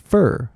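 "fur" as the weak form of "for"; "for" shrunk to a short "fur"; reduced for. Shown as a demonstration. Teacher shows the weak form, "for" as "fur". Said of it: "For会变".

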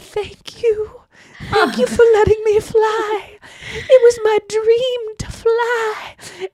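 grateful balloon. Retold or imitated. The balloon thanking the man for letting it fly, dreamy. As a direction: float it out like a grateful little balloon. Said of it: "the balloon comes back and is like".

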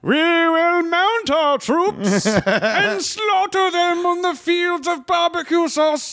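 high, grand proclamation of a burger monarch; a hammed-up knight rallying the troops for war; mock royal herald. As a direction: cry it out like a medieval herald, hammed-up. Mock king's herald and war cry.

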